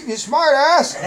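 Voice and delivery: high, loud, sputtering mock outrage; imitated angry principal. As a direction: stammer it out like a fed-up principal. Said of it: "he didn't take that too kindly".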